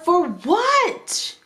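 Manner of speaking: sharp, high, exasperated retort; aggravated. Retold or imitated. Fed-up worker snaps at the creepy cashier, high and sharp. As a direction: fire it back at the creepy cashier. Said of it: "I'm so aggravated".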